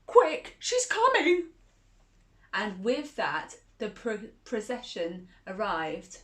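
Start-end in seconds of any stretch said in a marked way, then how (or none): 0.1-1.5 higher and louder, a panicked alert; alarmed warning. Acted. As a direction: cry it out in a sudden fright. Cards warn the Queen approaches.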